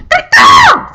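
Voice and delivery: piercing high squawk; angry crow caw. Acted. Angry crow squawking at the Baron, loud caw. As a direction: let out a loud, screeching caw. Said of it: "said the angry".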